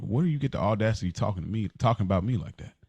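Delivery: ordinary talk, nothing marked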